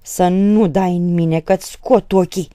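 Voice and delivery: loud, drawn-out hissed warning; hissing threat. A narrator's reading. Polina warning her father not to hit, hissing threat. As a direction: hiss it as a fierce threat. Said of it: "rosti Polina cu un glas șuierător".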